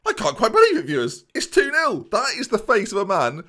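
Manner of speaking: can't believe the goal; squeaky thrilled disbelief; excited disbelief. Real, not acted. Disbelief after scoring second goal.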